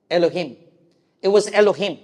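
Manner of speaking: ordinary talk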